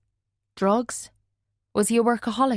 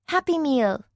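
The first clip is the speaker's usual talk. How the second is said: put-on high, eager child's cry; child calling out for a Happy Meal; excited little girl; 7 semitones higher than usual. A narrator's reading. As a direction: squeal it like a small girl wanting her treat. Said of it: "Maisie cried".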